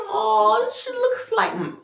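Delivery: high, surprised realization; surprised gasp. Retold or imitated. Recalling seeing the baby's profile, high surprise. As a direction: gasp as if seeing it first time. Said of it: "I was like".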